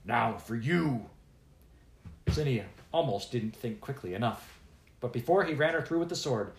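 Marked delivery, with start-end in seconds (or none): 0.1-1.1 slow, menacing dwarf growl; pirate-killer dwarf turning on the girl, slow growl; growled threat. Acted. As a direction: growl it at her, slowly. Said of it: "and he growled".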